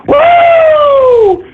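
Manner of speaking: loud high-pitched woo; high whoop. Real, not acted. Streamer celebrating angry trolls with a loud whoop. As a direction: let out a triumphant whoop.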